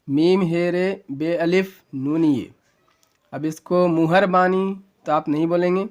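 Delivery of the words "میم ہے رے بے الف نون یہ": letters named one by one, slowly; slow spelling out. Shown as a demonstration. Teacher spelling مہربانی for students, slow letter by letter. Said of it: "مہربانی کیا ہجے کریں گے".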